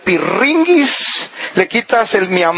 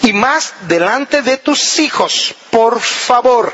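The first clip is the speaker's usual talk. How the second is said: slow, deliberate firm demand; authoritative without shouting; 2 semitones higher, slower than usual. Shown as a demonstration. Wife telling husband to stop shouting, with authority. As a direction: insist firmly, stretching the words, no shouting. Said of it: "con autoridad, pero sin gritar".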